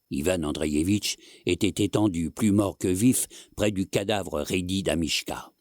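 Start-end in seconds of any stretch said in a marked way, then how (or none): none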